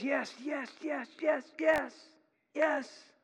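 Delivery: high-pitched string of eager affirmations; eager agreement. Acted. Believers agreeing with the Bible, hammed-up eagerness. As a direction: fire them off with exaggerated enthusiasm.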